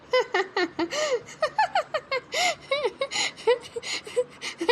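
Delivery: long high cackling laugh; mocking cackle. Mother-in-law cackles at the bahu's dance plan. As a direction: cackle at the very idea.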